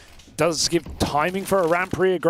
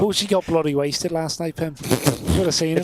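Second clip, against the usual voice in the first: put-on Scouse accent. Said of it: "it's a Liverpudlian Scouser accent".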